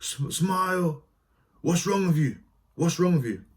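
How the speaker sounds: high, slow, mocking director voice; imitating the director. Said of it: "I remember just standing there".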